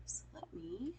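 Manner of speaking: whispered aside to herself. Real, not acted. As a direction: whisper it under your breath. Aside while setting up chart.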